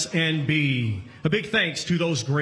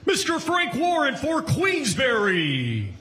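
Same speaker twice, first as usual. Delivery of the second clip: announcer callout; 6 semitones higher than usual. Real, not acted. MC introduces the promoters.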